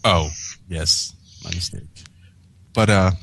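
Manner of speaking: normal talking voice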